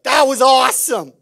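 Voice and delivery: loud, high burst of delight; excited fan. Retold or imitated. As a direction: shout it like your team won last night. Fan waking up after a win.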